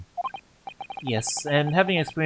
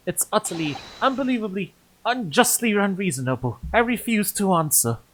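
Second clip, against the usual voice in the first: higher, offended tirade; haughty refusal; indignant complaint; 7 semitones higher than usual. Acted. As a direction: sniff at the insult, rising in pitch. Author complains about being numbered.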